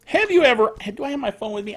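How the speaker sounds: usual speaking voice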